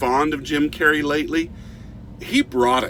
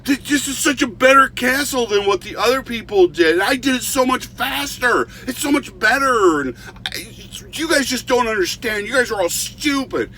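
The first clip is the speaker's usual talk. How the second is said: mock screaming rant; 9 semitones higher than usual. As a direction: rant like a spoiled artist nobody appreciates. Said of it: "this guy starts screaming out".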